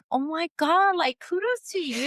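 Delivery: high, bright mock awe at a client with a cane; mock amazed. Telling the interviewer how she reacted, in mock amazement. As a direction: gush out a stunned kudos. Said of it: "and I'm sitting there like".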